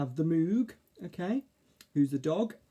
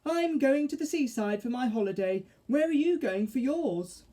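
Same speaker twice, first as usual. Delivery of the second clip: high, louder fairy voice; Mavis; 10 semitones higher, 4 dB louder than usual. A narrator's reading. Fairy asks caterpillar about holidays, high voice. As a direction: announce it high and loud.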